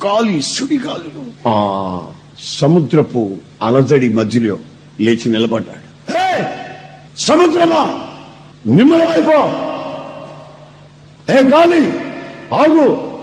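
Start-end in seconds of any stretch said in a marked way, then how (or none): 6.2-13.2 high, ringing command to the storm; commanding the sea. Retold or imitated. Jesus rebuking the sea and wind, high commanding call. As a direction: order the wind to stop, voice raised high. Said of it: "అని ఒక్కసారి అన్నాడండి".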